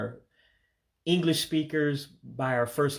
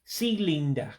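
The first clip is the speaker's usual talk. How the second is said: cylinder as silinda in an Igbo accent, pitched up; 3 semitones higher than usual. Shown as a demonstration. Teacher says cylinder with an Igbo accent. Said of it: "say it with sort of an accent".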